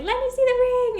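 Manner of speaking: high, loud, excited grandma imitation; imitated eager demand. Grandma in the kitchen asking to see it, imitated excitement.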